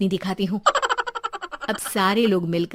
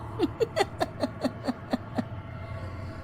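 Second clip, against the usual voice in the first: evil ghostly cackle; 10 semitones higher, 10 dB quieter than usual. Acted. Ghost laughs after threat.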